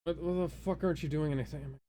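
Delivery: ordinary talk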